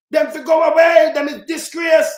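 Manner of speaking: angry outburst. Real, not acted. Vlogger denouncing the politicians, angry shout. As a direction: throw them out with your voice.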